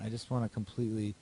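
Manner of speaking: ordinary talk, nothing marked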